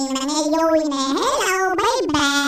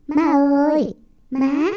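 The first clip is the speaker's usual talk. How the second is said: normal talking voice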